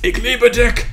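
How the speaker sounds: high, loud mock-angry bark; aggressive German. Shown as a demonstration. Streamer parodying German love words, aggressive shout. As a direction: bark it like a furious German command, jokingly. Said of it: "quite an aggressive sounding language".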